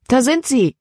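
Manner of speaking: high-pitched excited exclamation; Libby's high, eager call. A narrator's reading. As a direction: cry out on spotting them. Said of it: "rief Libby aus".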